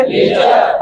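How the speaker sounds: barely voiced whisper of the word; whispered answer. Shown as a demonstration. Teacher whispering the answer to the class. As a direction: whisper it like a prompt. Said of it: "अगला word क्या है?".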